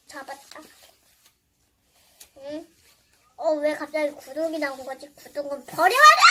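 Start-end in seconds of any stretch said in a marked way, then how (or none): 5.1-6.2 a loud, bossy shout of an order; loud command. Pretend. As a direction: bark it out like a playful villain. Throwing away the subscribe toy. (장난스럽게 크게 외치기)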